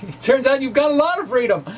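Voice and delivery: high, quick words broken by a laugh. Real, not acted. Lecturer tells listeners they have freedom, laughing. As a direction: chuckle through it, amused at the idea. Amused at huge number of codes.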